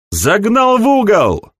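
high triumphant yell; shouting. Acted. Pursuers call out in the dark.

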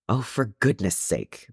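ordinary delivery, nothing marked

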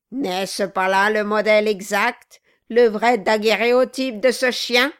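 loud raging snarl, pitch up; furious dwarf. Acted. Quilp yells at the figurehead as he beats it, enraged. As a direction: rage and pound with every word.

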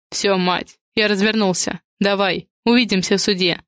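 plain reading voice

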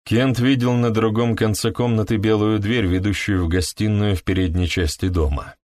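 ordinary delivery

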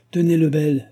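high, loud summons; calling out. Acted. King hands his valet a letter, calling out. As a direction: call the servant over briskly.

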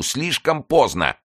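high, drawn-out cry of despair. A narrator's reading. Mazarin despairs again, high slow cry. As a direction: stretch the despair out slowly. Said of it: "хватаясь за голову".

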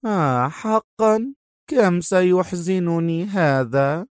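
slow, mocking mock-sorrow; sarcastic. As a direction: drip false pity. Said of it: "في سخرية".

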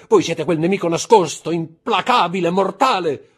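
frantic, very high, loud pleading; terrified outcry. Acted. Villefort demanding his hidden enemy's name, terrified. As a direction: cry out as dread overwhelms you.

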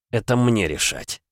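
ordinary delivery, nothing marked

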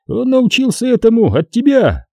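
high, pointed villain jibe; purring taunt. A narrator's reading. Xanatos blaming Qui-Gon for the boy's ruthlessness, taunting. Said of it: "промурлыкал Ксанатос".

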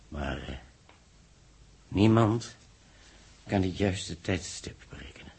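breathless whisper of a dreadful secret. Acted. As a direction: drop to a hushed whisper. Dark secret about the nova. (fluisterend, onheilspellend)

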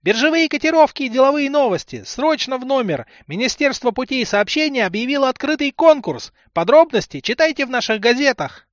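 high, loud, fast newsboy chant; cheerleader. A narrator's reading. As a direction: chant the headlines at a paperboy's pitch. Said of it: "завёл подходящую кричалку".

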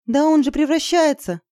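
high-pitched, alarmed exclamation; horrified. Acted. Izergil seeing him transform, horrified. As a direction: cry out in horror. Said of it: "с ужасом сказала Изергиль".

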